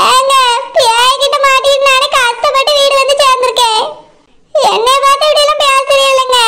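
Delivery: a pleading wife's voice, put on. Wife pleads with disbelieving husband, put-on voice. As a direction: plead your case to a doubting husband. Wife protests her hardship.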